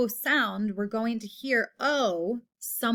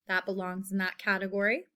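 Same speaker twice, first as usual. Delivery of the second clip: ordinary talk, nothing marked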